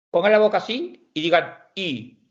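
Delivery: usual speaking voice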